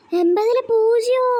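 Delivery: shocked disbelief. Acted. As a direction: drag out the shock at the zero mark. Mother finds zero marks. (എൺപതില് പൂജ്യമോ എന്ന് പതുക്കെ ഞെട്ടലോടെ)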